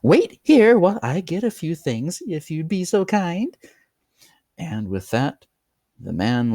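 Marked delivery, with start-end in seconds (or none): 0.0-3.6 higher, loud, falsely polite host. A narrator's reading. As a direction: fake politeness. Host voiced.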